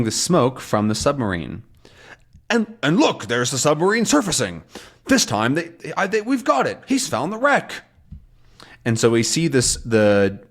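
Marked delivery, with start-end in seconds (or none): 2.5-7.8 much higher excited report; excited sailor. Retold or imitated. Crew announces the submarine found the wreck, higher and excited. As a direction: burst out with the good news. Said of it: "excited sailor report".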